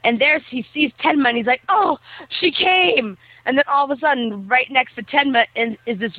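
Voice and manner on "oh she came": high, slow, drawn-out delight; mock elation. Boy sees that Tenma came, mock elation. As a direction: imitate a lovestruck boy's happy gasp. Retelling Kenji spotting his crush.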